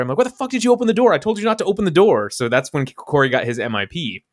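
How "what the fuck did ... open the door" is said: high, loud angry yelling at a friend; retold yelling. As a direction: chew out Corey for opening the door. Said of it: "yelling at Corey".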